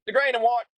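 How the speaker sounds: single out the truck, needling; angry trucker on the radio. Retold or imitated. Angry driver naming the truck over radio, pointed. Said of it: "And he said".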